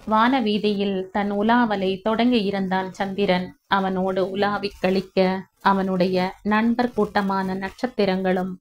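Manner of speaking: plain reading voice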